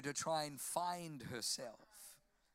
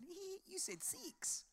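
high, soft giggly Kiwi imitation; squeaky mock giggle into a Kiwi accent; 7 dB quieter, slower than usual. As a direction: mimic a tittering New Zealand teller. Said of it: "this girl had a Kiwi accent".

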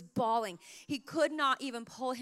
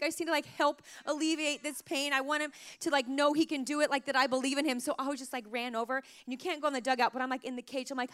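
ordinary talk, nothing marked

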